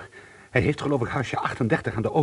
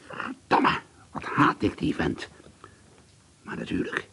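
muttered curse; 6 semitones lower, slower than usual. Acted. Muttered to himself after.

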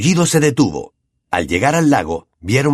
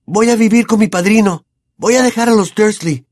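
high desperate self-talk; 11 semitones higher, 4 dB louder than usual. A narrator's reading. Harry's desperate thought.

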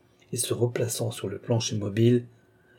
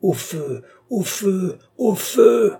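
alarm cry climbing to a loud high peak; each cry higher, ending in a shout; cries of rage; 10 semitones higher, 5 dB louder, much slower than usual. A narrator's reading. Old man cries fire to rouse Balsamo, raging. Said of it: "Il articula ses cris avec une telle rage".

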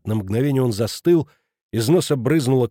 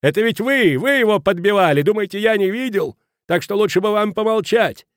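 high, loud indignant tirade; furious accusation; 9 semitones higher, 4 dB louder than usual. A narrator's reading. Headmaster accusing Kraner of egging him on, furious. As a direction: accuse at full outraged pitch. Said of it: "разъярился директор".

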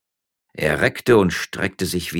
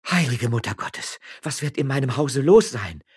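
higher-pitched anxious moan; moaned dismay; 8 semitones higher, 3 dB louder than usual. A narrator's reading. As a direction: lament it with mild dread. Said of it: "stöhnte Frau Frasquita".

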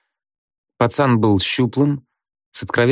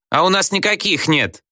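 much higher pupil's protest; defiant outcry; 10 semitones higher than usual. A narrator's reading. Pupils protest to the teacher about notebooks, defiant outcry. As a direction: pitch it up as a classroom heckle.

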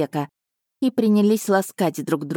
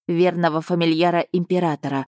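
ordinary delivery

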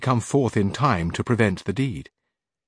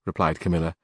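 ordinary delivery

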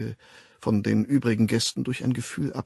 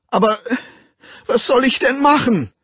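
much higher, loud desperate howl; desperate wail; 9 dB louder than usual. A narrator's reading. Bernard wailing at the locked door in despair, very high and loud. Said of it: "jaulte Bernard verzweifelt".

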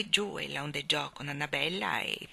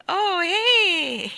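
acuto e forte; grido dei gemelli; 13 semitones higher, 9 dB louder than usual. A narrator's reading. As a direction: gridolino dei piccoli che volano. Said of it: "gridarono i gemelli". (gemelli gridano acuti)

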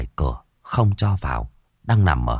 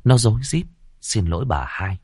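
ordinary delivery

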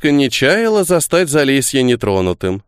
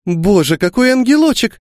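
delighted exclamation; 11 semitones higher than usual. A narrator's reading. Grusha seeing the little girl, delighted exclamation. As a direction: gush over a sweet little girl. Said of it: "всплеснула руками Груша".

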